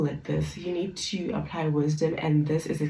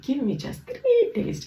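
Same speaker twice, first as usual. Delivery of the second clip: much higher, slow desperate plea; pleading; 11 semitones higher, slower than usual. Retold or imitated. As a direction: beg the king, voice leaping up. Terah pleads with the king.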